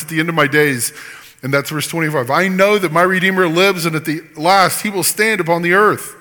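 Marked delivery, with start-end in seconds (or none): 2.3-6.2 fervent scripture reading. Acted. Reads Job's words with fervor.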